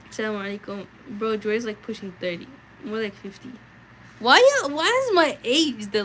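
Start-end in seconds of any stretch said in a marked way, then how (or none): 2.8-6.0 high, loud, slowed protest; mock outrage. Real, not acted. Streamer protests chat's jokes about her age, mock-outraged. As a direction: pretend offence at the age jokes.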